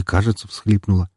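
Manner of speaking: ordinary delivery, nothing marked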